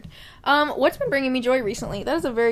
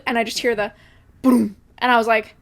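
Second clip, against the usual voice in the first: ordinary delivery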